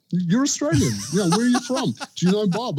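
a light mock of excited Americans; imitating eager locals. Everyone he meets quizzing the Australian, light mock voice. Every person he met asking.

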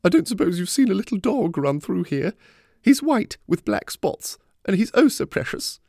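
high-pitched woman fretting over a lost pet; worried woman. A narrator's reading. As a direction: plead for help in a high, worried voice. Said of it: "the worry in her eyes and hear it in her voice".

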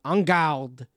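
a badly faked French accent. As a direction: call out en garde in a clumsy French accent. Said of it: "That was a horrible French accent".

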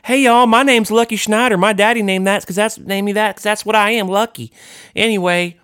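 bright showman pitch, way up; hyped Lucky voice. Pretend. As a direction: kid musician from Austin hamming it up. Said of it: "He wouldn't talk like that".

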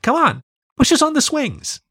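put-on high pleading child; little girl voice. A narrator's reading. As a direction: tug at him like an eager kid. Child character begging.

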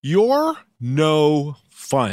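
slow playful pout; mock pouting. Real, not acted. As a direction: drag it out like a sulky tease. Teasing after refusal.

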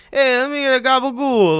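loud, high-pitched mock deli order; Italian-American deli customer. Podcaster mocking the nine-month accent, as a loud deli customer. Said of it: "go to a deli and be like".

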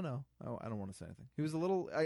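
ordinary talk, nothing marked

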